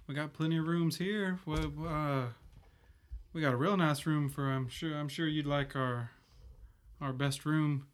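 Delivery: put-on bashful little boy; blushing young boy. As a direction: stammer out eager help like a smitten lad. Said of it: "he's kinda, like, blushing".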